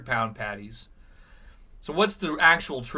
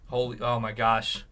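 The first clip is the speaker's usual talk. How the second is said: normal talking voice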